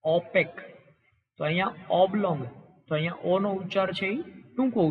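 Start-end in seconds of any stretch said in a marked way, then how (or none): 0.0-0.5 ઓપેક with a long, drawn "oh" at the start; long o in opaque. Shown as a demonstration. Teacher showing trainees the long o in ઓપેક. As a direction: stretch the opening o of ઓપેક. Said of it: "લાંબો ઓ એવું દર્શાવવું હોય તો".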